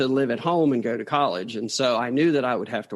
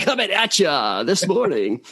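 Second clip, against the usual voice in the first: slow, loud, higher radio DJ voice; disc jockey voice; 5 semitones higher, 4 dB louder than usual. Retold or imitated. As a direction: ham up a morning radio host. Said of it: "I could see myself saying".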